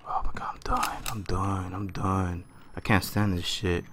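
whisper, barely breathing the words; hushed whisper. Real, not acted. Gamer hiding from the monster, whispering in fear. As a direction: whisper so the monster can't hear.

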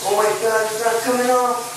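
real alarm rising in the voice; freaking out. Real, not acted. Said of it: "I'm freaking out right now".

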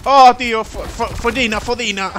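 excited yell. Real, not acted. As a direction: burst out in surprise. Surprised by the game.

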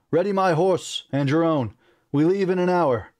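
high, forceful orders; urgent command. A narrator's reading. Prince ordering servant to ready horses, urgent. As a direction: rush the servant along.

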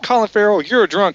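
imitated girlfriend's scolding, high and insistent; girlfriend joining in. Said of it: "then the girl comes over, she goes".

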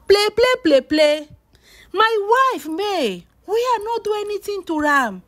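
high-pitched mock protest; aggrieved protest. Vlogger voicing a husband about his wife, aggrieved protest.

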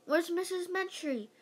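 high-pitched, restrained yell; mildly yelled searching question. A narrator's reading. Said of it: "yelled Missus Jaffe".

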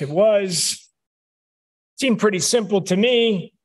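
ordinary talk

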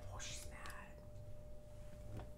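slow breathless whisper for the dog. Pretend. Owner voicing her dog's thoughts in a slow whisper. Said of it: "She's like".

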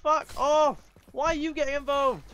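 high, loud exasperated yell; loud, high-pitched outburst; angry shouting. Real, not acted. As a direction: shout down someone butting in. Shouting at a player joining in.

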